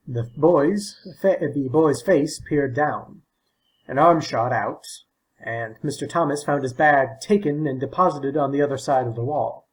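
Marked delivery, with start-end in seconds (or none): none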